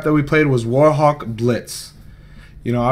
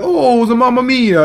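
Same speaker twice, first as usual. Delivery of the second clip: high, hammed-up Italian accent, much higher pitch; mock the Italian players with a singsong Italian accent; 11 semitones higher, 5 dB louder than usual. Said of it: "the Italians".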